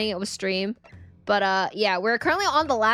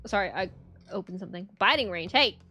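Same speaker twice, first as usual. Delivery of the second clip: normal talking voice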